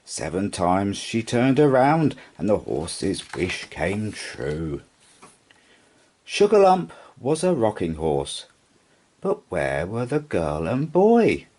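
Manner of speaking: ordinary delivery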